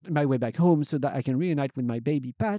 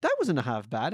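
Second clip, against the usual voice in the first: usual speaking voice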